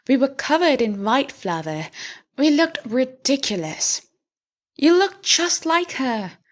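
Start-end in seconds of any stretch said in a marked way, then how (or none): none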